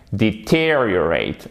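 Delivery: "deteriorate" slow, stretched second syllable; stress on TER. Shown as a demonstration. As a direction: punch TEER in "deteriorate", then fall. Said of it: "vous mettez l'accent tonique ici".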